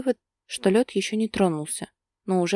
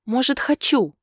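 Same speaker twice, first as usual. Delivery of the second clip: higher, louder spiteful snap back; angry retort; 7 semitones higher, 5 dB louder than usual. Acted. Wife snapping at her man in bed, angry. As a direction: throw it at him out of spite. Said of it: "зло высказалась девушка".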